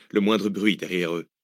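ordinary delivery, nothing marked

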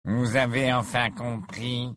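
put-on slow, sneering young voice; drawn out with a sneer; sarcastic. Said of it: "d'un ton sarcastique".

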